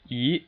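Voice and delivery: E rising steadily; second tone. Shown as a demonstration. Teacher shows learners the second tone, steadily rising E. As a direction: slide E upward with no dip at all. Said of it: "that's the second tone".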